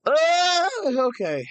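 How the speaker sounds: high loud sob-like ah; choked-up cry. Real, not acted. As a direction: let a sudden cry burst out then steady. Fighting tears over streamer leaving.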